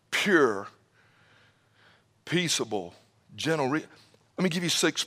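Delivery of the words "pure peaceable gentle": softened list. Acted. Preacher listing virtues softly.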